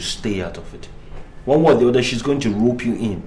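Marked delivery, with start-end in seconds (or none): 1.4-3.2 angry warning. Acted. Heated marital argument.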